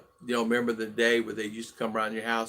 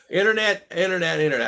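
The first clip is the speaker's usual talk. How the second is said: repeats the word with real delight, loud and high; excited; 7 semitones higher, 6 dB louder, faster than usual. Real, not acted. Streamer cheering to chat that internet works, excited. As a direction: cheer the word like good news finally arrived. Celebrating the internet coming back.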